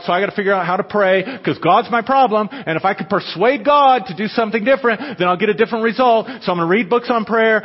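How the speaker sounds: loud, high fired-up preaching; preaching at full force. Acted. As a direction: preach it hard to the crowd.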